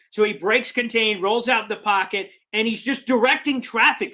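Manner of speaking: ordinary talk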